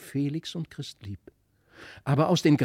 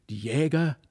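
ordinary delivery

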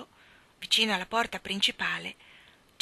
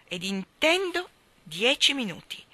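"dieci minuti" set apart and underlined; emphatic; 5 semitones higher, 4 dB louder than usual. A narrator's reading. Mary Poppins insisting to the children, stressing "dieci minuti" after a break. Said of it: "ella sottolineò".

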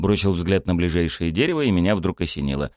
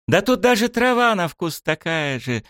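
высокий восторженный вопль; завопи высоко, по-детски; вопль принцессы; 12 semitones higher than usual. A narrator's reading. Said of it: "завопила принцесса". (завопила с восторгом)

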